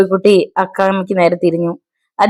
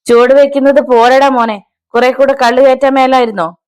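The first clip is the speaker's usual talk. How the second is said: higher, sarcastic father; mocking; 6 semitones higher, 3 dB louder than usual. A narrator's reading. Father mocking his drunk son, sarcastic. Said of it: "Avarachan പരിഹസിച്ചു".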